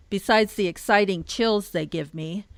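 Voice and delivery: normal talking voice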